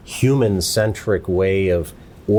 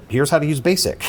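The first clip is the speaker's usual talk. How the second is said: higher, brighter quoting voice; manual voice; 7 semitones higher than usual. Retold or imitated. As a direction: read it like an old instruction manual. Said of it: "the damn manual said".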